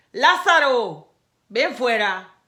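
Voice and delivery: "Lázaro" called out slowly and loudly. A narrator's reading. Jesus calling Lazarus from the tomb, loud slow call. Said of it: "clamó a gran voz".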